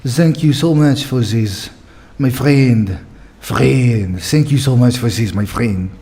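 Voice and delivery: French accent with th as z. Shown as a demonstration. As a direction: practise a French accent, carefully copying a coach. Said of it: "so it becomes zis and zat".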